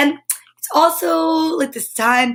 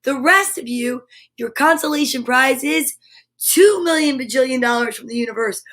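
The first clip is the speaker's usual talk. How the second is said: ordinary delivery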